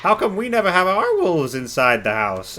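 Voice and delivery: squeaky little goblin asking; goblin child voice. Acted. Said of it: "a goblin says".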